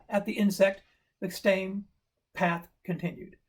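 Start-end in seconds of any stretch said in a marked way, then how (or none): none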